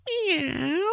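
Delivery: high squeaky imitated meow; cat meow. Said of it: "like a cat".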